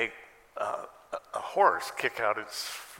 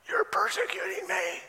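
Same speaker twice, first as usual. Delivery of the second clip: very high, strained outburst; hammed-up indignant complaint, pitched way up; mock angry accusation. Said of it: "believe for a second he said it angrily".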